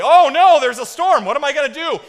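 loud, high-pitched hammed-up panic; mock panic. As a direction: wail it in pretend alarm. Mock panic Jesus never showed.

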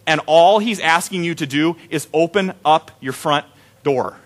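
ordinary talk, nothing marked

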